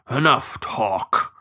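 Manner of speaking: slow bloodhound growl. A narrator's reading. Bloodhound sheriff cutting off his partner, growled. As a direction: growl it slowly, ending the debate. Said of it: "the bloodhound growled".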